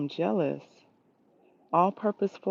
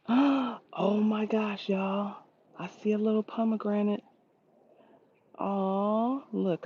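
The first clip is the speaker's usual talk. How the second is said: gasp, then higher with excitement; delighted surprise; 5 semitones higher than usual. Real, not acted. Gardener spotting her first pomegranate, gasping in delight. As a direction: gasp like you just found treasure. Spots fruit on her tree.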